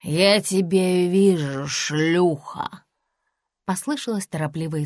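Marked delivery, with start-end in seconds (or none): 0.0-2.9 put-on slow, dragged drunken jeer; mild drunk woman jeering at her rival; drunken taunt. A narrator's reading. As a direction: lean into a sloppy drunk insult. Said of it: "пьяный женский голос".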